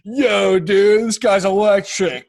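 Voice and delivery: loud, slow, drawn-out bro cheer; mock frat bro hype. Frat guys hyping up a homeless man, loud mock cheer. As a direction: ham up the dazzled frat boy.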